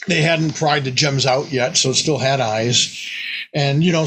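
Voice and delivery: ordinary delivery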